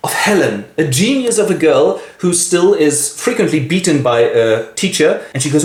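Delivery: usual speaking voice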